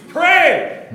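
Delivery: loud clear command. Retold or imitated. Pastor imitating mother's loud "pray.". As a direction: bark out "pray." like a sharp order. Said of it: "in a very loud, clear voice".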